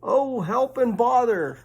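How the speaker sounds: high, slow lament; woeful. Acted. Pooh stuck in the hole, slow high lament. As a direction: drag out the misery.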